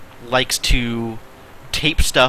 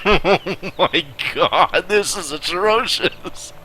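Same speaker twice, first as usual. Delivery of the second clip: laughing through it, higher and slow; 6 semitones higher, slower than usual. Real, not acted. Reacting to the kid's story, laughing. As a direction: crack up at the awful writing.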